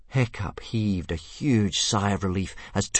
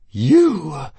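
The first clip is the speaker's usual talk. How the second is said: a menacing, louder hiss; hissing dragon; 7 semitones higher, 6 dB louder than usual. A narrator's reading. Fireworm hissing at Toothless, louder and menacing. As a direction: spit the word at him. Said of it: "hissed Fireworm".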